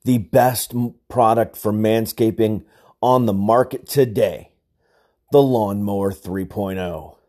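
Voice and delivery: loud, slow ad read. Acted. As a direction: sell it like a commercial. Reads sponsor ad.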